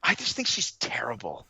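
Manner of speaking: whispered aside; whispering. Real, not acted. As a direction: whisper it like a confession. Confiding opinion on wrestler.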